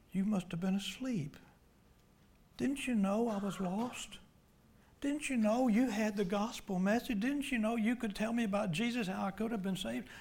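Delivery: anguished reproach. Acted. Acting a lost soul's reproach.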